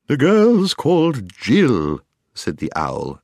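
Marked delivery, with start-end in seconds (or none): 0.0-2.0 high, slow, hooted shout; loud owl call. A narrator's reading. Owl introducing Jill to a deaf dwarf, loudly. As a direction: call it out loudly, stretching each word. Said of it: "as loud as it could".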